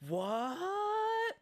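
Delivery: sudden very high, stretched what; shocked what. Retold or imitated. Telling friends about the movie twist, a high shocked what. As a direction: recall the jolt of the twist with one high cry. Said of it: "made me go".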